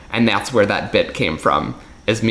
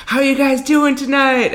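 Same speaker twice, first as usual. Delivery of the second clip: hype host; 13 semitones higher than usual. Retold or imitated. Magician mocking a hype host's opener, pumped-up emcee voice. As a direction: work the crowd like a cheesy entertainer. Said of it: "I'd go".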